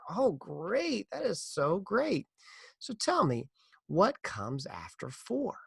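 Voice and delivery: teacher cooing over a pupil, slowing down; hint of a cheery schoolteacher; lightly voiced teacher.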